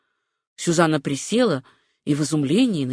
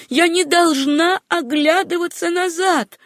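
higher, drawn-out insistent repeat; more emphatic insistence; 10 semitones higher, 3 dB louder than usual. A narrator's reading. Susanna repeats her vow to herself, slow, high, emphatic. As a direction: drive it home slowly at a high pitch. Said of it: "твердила она".

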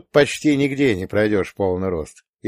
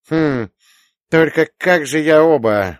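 put-on old prospector; 6 semitones higher, 5 dB louder than usual. A narrator's reading. Old man puzzling over trucks.

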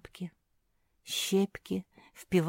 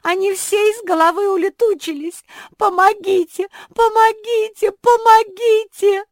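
panicked cries for help; 13 semitones higher, 14 dB louder than usual. A narrator's reading.

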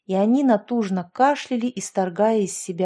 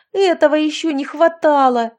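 panicked shout; 6 semitones higher, 6 dB louder than usual. A narrator's reading. Panic as girls drown.

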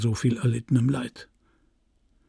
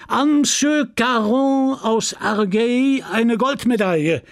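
high-pitched, ringing prize announcement; chairman calling out; 9 semitones higher, 3 dB louder than usual. Acted. Chairman awards a gold medal to a farmer, calling out. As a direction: proclaim it to a whole crowd.

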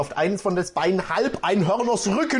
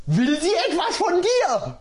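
horse voice; 11 semitones higher than usual. Acted. Horse asks Regine.

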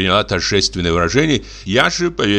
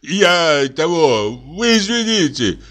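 fumbling apology; 9 semitones higher, slower than usual. Acted.

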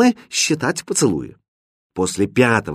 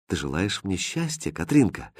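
very soft, gentle asking; hushed, tentative question; quiet question; 3 semitones lower, 7 dB quieter than usual. A narrator's reading. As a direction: ask it quietly, almost privately. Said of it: "тихо спросил Хрустальд".

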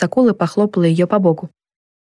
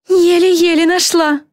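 high, slow shout; calling out; 11 semitones higher, slower than usual. A narrator's reading. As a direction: call it out high and drawn out. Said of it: "крикнула она". (протяжный выкрик высоким голосом)

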